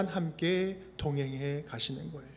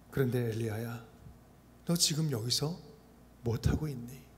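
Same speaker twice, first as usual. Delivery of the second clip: softer, lower, tender question; gentle coaxing; 6 semitones lower, 7 dB quieter than usual. Pretend. God softly coaxing the worn-out Elijah, tender. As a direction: ease him into talking, step by step. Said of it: "차근차근 대화를 통해서".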